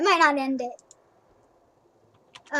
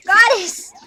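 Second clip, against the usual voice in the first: sharp call; 4 semitones higher, 8 dB louder than usual. Real, not acted. Calling the cat sharply.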